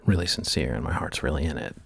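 soft, low vocal fry. Real, not acted. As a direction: sink into a low, near-inaudible fry. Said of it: "I become almost inaudible, a being of pure vocal fry".

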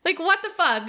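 high-pitched shocked what the; shocked disbelief. Retold or imitated. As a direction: squeal it out in disbelief. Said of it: "And I was like".

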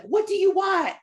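high, loud mocking voice; shrill-free high pitched mock demand; imitating viral audio. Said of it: "real audio that went around that was like".

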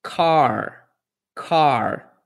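car with an American R at the end; English R demo. Shown as a demonstration. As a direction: say car with a curled final R.

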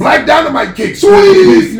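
high, loud, hammed-up attack call; mock fight shout. Acting out his kick on Apocalypse for friends, mock fight shout.

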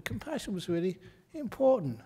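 ordinary talk, nothing marked

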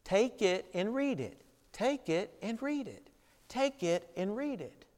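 put-on hushed, lightly sing-song child's chant. A narrator's reading. A hint of the child's sing-song voice Augustine hears. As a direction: softly chant it like a child at play. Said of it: "a child's sing-song voice repeating".

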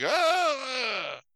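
high, drawn-out mock scream. Dogs seeing a ghost, high mock scream. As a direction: play a hammed-up cry of fright.

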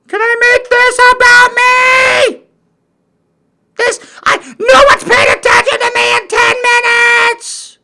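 very high, loud mock-crying tantrum; wailing attention seeker. Needy internet person begging to be noticed, screeching wail. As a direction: scream and wail for attention like a huge baby.

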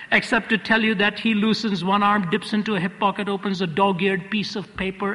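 normal talking voice

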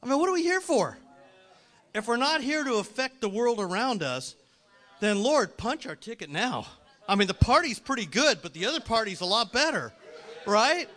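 high, loud full-force preaching; impassioned preaching. Acted. Preacher challenging congregation on why we are here, impassioned. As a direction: challenge the crowd at the top of your voice.

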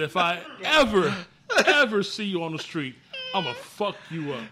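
loud, high mock-furious threat; retold threat. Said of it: "I threatened his life".

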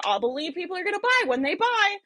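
mock doubtful entrepreneur voice; mocking skeptic voice. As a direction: mimic the skeptical seller. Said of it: "that I am acting out for you guys".

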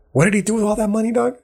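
high, fast, disbelieving question; incredulous. Retold or imitated. Looking back at Gates' billions, high fast disbelief. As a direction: jump up in pitch and rush it, baffled.

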